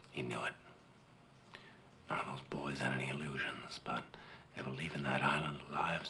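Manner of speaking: soft, low and solemn; quietly recounting a death in battle; grave, hushed telling. Acted. Recounts grandfather facing certain death.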